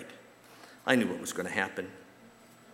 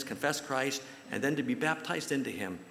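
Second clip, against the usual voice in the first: normal talking voice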